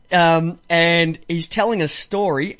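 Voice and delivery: normal talking voice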